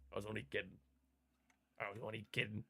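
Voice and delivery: softly muttered take-back; quiet. Real, not acted. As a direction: say it under your breath. Teasing, mock apology.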